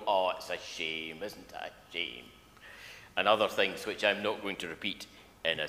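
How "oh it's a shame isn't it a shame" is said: Frankie Howerd. Retold or imitated. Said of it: "Frankie Howerd would turn to the camera and he would go".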